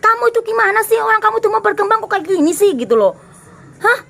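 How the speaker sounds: high, sharp scolding to wake them up; a wake-up scold to a listener who won't change; mock scolding. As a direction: scold like a big sister who has had enough. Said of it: "perlu dijedokin tembok juga".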